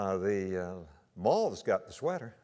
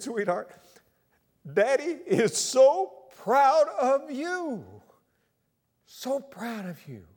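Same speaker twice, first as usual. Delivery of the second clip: slow, higher, choked with pride; tender relief; 13 semitones higher, 8 dB louder, much slower than usual. Retold or imitated. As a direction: hold her and pour out pride. Father embraces rescued daughter.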